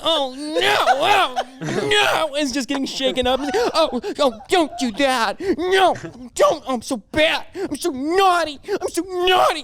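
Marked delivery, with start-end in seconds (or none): 0.0-2.3 high, whining sleep-talker moans while being shaken; high, squealing protest, half asleep; squealing, naughty sleep-talk moans; dirty sleep talker. Acted. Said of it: "dirty sleep talking". 3.7-9.6 high, whining sleep-talker moans while being shaken; high, squealing protest, half asleep; squealing, naughty sleep-talk moans; dirty sleep talker. Acted. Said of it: "dirty sleep talking".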